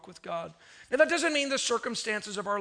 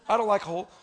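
ordinary talk

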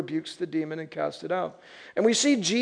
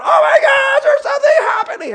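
very high, loud panicked squeal; hammed-up hysterical cry; mock freak-out; 8 dB louder than usual. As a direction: imitate a crowd shrieking in alarm. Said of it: "You see people freaking out".

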